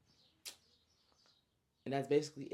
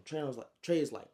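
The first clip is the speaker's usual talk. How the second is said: usual speaking voice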